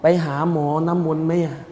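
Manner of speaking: soft, hushed wife's plea to her husband; wife pleading softly. Retold or imitated. Wife urging her sick husband, softly pleading. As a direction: coax him gently like a worried wife. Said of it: "จึงบอกกับสามีว่า".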